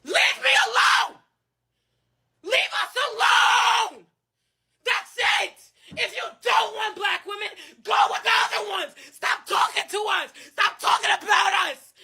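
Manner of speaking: angry crying. Real, not acted. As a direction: yell it out, sobbing in rage. Crying rant against critics.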